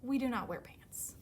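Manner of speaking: firm words in a whisper; whispered firm reply. Acted. Parent panda tells the cub, a firm whisper. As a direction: hush it, but mean it.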